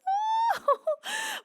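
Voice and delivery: squealing laugh; delighted high-pitched laugh. Real, not acted. Reacting to a sweet chat message.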